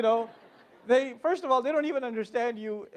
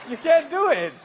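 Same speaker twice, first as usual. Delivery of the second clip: laughing insist; 5 semitones higher, 9 dB louder than usual. Real, not acted. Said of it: "laughing insistence".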